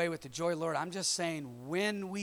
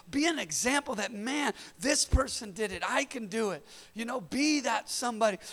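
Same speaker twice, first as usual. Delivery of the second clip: rising, louder preacher's exhortation; preaching; 5 semitones higher, 4 dB louder than usual. Acted. Pastor urging the congregation to be a conduit of freedom, fervent preaching. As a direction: build the call to action.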